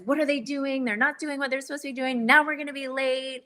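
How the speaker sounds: mildly hammed-up flustered mom running late; irritated inner voice. Mother replaying her rushed thoughts at school pickup, exasperated. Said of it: "I was all irritated".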